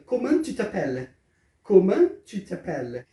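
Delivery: "Comment tu t'appelles?" sem o T final de "comment"; francês devagar. Shown as a demonstration. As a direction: diga em francês, com calma. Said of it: "Então a pergunta fica". (pergunta em francês pronunciada devagar)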